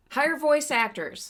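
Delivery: slow, loud, punched plea; emphatic plea. Real, not acted. Podcast host ends her rant with a slow, loud demand. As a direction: spell it out like a closing demand. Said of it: "I'm done with my rant".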